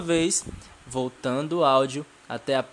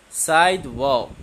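slow model word; 6 dB louder, slower than usual. Shown as a demonstration. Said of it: "Could you repeat with me?".

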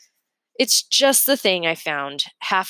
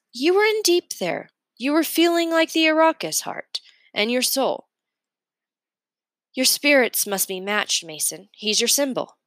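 put-on a higher, lighter boy's voice, encouraging; Calvin's voice; 7 semitones higher than usual. Calvin urges Mason to try the oil crayons, higher and encouraging. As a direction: coax a friend to try the crayons.

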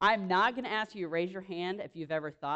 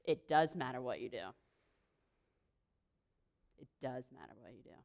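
lower, stern parental lecture; a hint of a stern parent, lower; parent's voice; 9 semitones lower, 9 dB quieter, faster than usual. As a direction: drop into a disapproving parent. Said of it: "voicemail from your mom or dad and saying".

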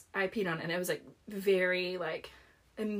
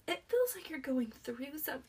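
high, soft concerned boss voice; gentle mock concern; 6 semitones higher, 6 dB quieter than usual. Boss checking on weeping substitute teacher, gentle concern. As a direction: tread carefully, imitating a worried boss.

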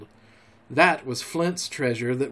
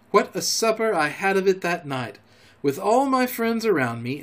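ordinary delivery

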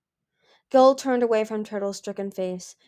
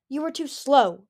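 cold blame; 6 semitones higher, 5 dB louder than usual. Acted. Gil blaming his son.

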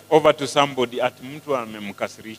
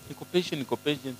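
soft self-assurance; 2 semitones lower, 14 dB quieter, faster than usual. Retold or imitated. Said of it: "Huwezi jua sasa".

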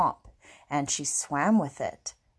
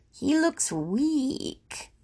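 high, very slow whine of complaint; dismayed, drawn-out grumbling; 8 semitones higher, much slower than usual. Acted. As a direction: stretch each word in a high grumble. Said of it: "and she grumbled".

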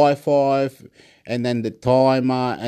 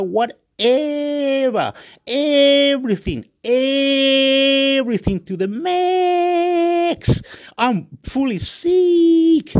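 high, long-held hollers; over-the-top hype; 12 semitones higher, 3 dB louder, much slower than usual. Real, not acted. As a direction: stretch the key vowels way out, high and rising in force. Hyping himself up to the max.

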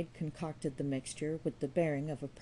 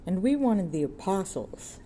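sneering; 3 semitones higher, 6 dB louder than usual. A narrator's reading.

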